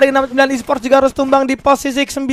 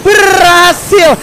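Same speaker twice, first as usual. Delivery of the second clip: hype esports caster; 5 semitones higher, slower than usual. Real, not acted. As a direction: build to the winning moment. Final duel, hype play-by-play. (komentator heboh saat kemenangan)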